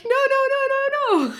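mock desperate refusal, giggling through it; laughing protest. Mother clinging to her pumped milk, laughing high plea.

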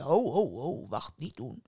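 low, chuckling giant's ho ho ho; giant laughing. A narrator's reading. Giant laughing off the boy's plea. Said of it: "lachte de reus".

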